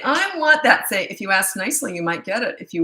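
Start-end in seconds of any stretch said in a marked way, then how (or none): 0.0-0.9 put-on whiny child. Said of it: "So they say".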